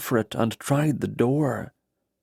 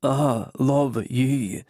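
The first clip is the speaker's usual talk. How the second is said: tender brogue; much slower than usual. A narrator's reading. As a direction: linger on love, unhurried and tender. Kenrick's tender declaration to Alison.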